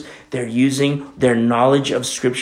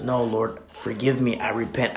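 quiet repentance; 5 semitones lower, 9 dB quieter than usual. Retold or imitated. Repentant prayer voiced.

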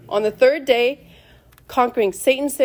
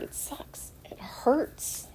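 near-whisper, much softer; hushed admission; 4 semitones higher, 11 dB quieter, much slower than usual. Real, not acted. Preacher admitting to listeners that growth is hard, whispered. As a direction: drop to a whispered confession.

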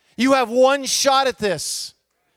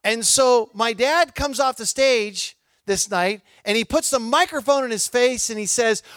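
ordinary talk

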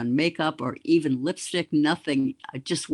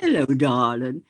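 a hint of a gruff Boston old-timer greeting a friend; Tip O'Neill voice; 3 semitones lower, 3 dB louder than usual. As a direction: repeat the imitation, a touch warmer. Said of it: "in that wonderful voice of his".

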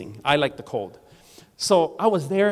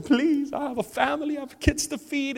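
higher, pleading, a touch of tears; pleading servant; 9 semitones higher than usual. Retold or imitated. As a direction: beg the king for mercy in a raised voice. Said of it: "He starts crying".

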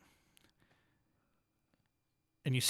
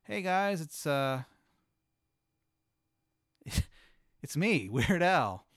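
[name]; 4 semitones higher, 4 dB louder, slower than usual. Acted. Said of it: "he grabs the mic from you. And he goes".

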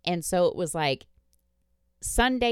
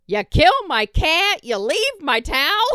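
high, loud mock-outraged kid; 12 semitones higher, 11 dB louder than usual. Kid accusing mom over towel, high outrage. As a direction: shriek the accusation, laughing. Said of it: "He goes".

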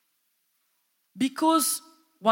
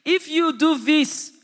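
loud, high taunting tempter; Satan tempting; 6 semitones higher, 7 dB louder than usual. Acted. Satan taunts Jesus, loud and high-pitched. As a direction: push hard, baiting Jesus loudly. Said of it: "Satan comes".

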